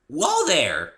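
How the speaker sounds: high, loud cheerful call; perky pony voice. A narrator's reading. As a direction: catch him like a bubbly pony. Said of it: "she said".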